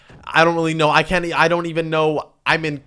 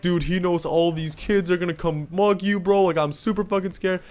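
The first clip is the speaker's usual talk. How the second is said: higher-pitched mock worry; imitated freaking out; 4 semitones higher than usual. Friend warning him about the seniors, imitated mild panic. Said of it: "freaking out".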